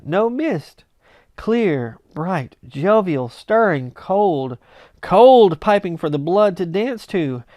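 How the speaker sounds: ordinary delivery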